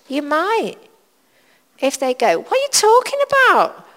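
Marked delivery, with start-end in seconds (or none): 2.4-3.9 puzzled friend. Retold or imitated. Friend's baffled reply to your faith.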